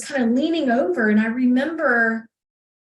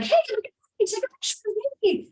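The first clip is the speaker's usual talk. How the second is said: high-pitched, lighter mock voice; posing child's request; 11 semitones higher, 4 dB quieter, faster than usual. Girl on a tree asking for a photo, posing child's request. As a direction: re-enact an eager squeak, much higher. Posing for a picture, acted quote.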